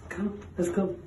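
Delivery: ordinary talk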